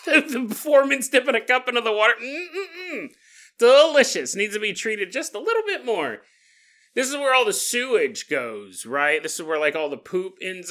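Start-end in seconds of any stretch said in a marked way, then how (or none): 2.2-4.4 mock savoring. Foreman tasting sewage water.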